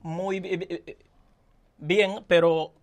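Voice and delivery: stammering, stuck on syllables; stuttering. Real, not acted. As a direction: stutter through each word. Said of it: "no es tartamudo".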